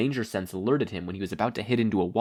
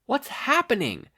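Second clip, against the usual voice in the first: higher-pitched alarmed yell; startled young hero yelling. Acted. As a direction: call out in alarm. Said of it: "Izuku yelled".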